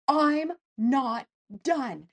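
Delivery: I'M NOT DONE slow, loud, each word punched; defiant, word by word. Retold or imitated. Patient vowing to survive, "I'm not done" slow and defiant. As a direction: hit "I'm", "not" and "done" separately, high and firm. Defiant vow from hospital bed.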